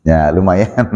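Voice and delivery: low, half-hearted acted answer; lukewarm reply. Another trader asked about the day's sales, lukewarm. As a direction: answer as if business is merely so-so. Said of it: "ada nggak intonasi lain?".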